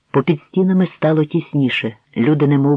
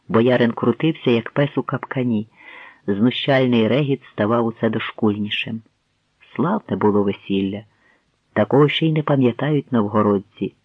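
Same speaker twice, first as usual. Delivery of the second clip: ordinary delivery, nothing marked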